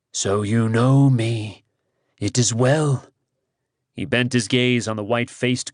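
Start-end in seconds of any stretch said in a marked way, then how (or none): 0.1-3.1 slow, lower, lordly assurance; godlike calm. Acted. Kars as Rhiannon greets the guard's recognition, slow and lordly. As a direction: savor the power, unhurried.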